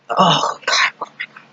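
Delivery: breathless whisper of disgust; a horrified whisper at the memory. Retold or imitated. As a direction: breathe it out, grossed out. Recalling the moldy hot dog.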